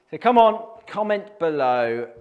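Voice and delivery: loud, high rallying call; calling out to viewers. Real, not acted. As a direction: rouse the viewers to comment.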